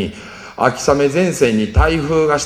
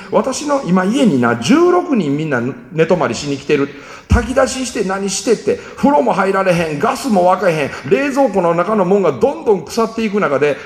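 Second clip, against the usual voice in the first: 1 tearful scolding; 6 semitones higher than usual. Retold or imitated. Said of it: "うわー泣きながら電話で僕に大声出して怒ってきて".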